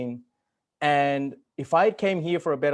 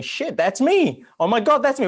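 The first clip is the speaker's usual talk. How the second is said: excited realization; 9 semitones higher, 7 dB louder than usual. Retold or imitated. Reliving his aha moments reading the book, excited exclaim. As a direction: exclaim it like a sudden epiphany. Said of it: "aha moments going".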